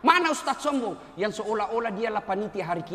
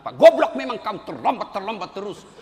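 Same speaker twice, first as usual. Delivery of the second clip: loud insulting scolding; imitating a boss swearing at a late subordinate; cursing out; 9 dB louder than usual. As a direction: yell the insult at him. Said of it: "kalau dimaki-maki".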